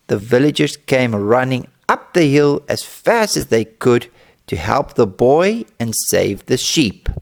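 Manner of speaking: plain reading voice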